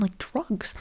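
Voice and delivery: whispered aside on drugs. Real, not acted. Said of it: "whispered word drugs".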